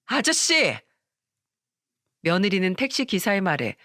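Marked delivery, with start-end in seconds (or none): 0.1-0.8 high, angry exclamation; acted burst of temper, pitched up; flaring up. A narrator's reading. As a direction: cut the driver off with an indignant cry. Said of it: "버럭 화를 냈어요".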